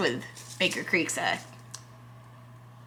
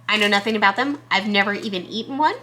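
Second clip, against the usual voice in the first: normal talking voice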